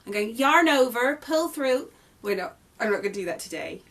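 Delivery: mock American accent for the stitch names. Crochet designer imitating American YouTube tutorials, light American accent. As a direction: slip into an American accent, half joking. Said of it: "in my nice regular, uh, English accent".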